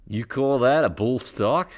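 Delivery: mock the small knife, Aussie style; hammed-up Crocodile Dundee Australian accent; Mick impression. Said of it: "Remember Mick from Crocodile Dundee?".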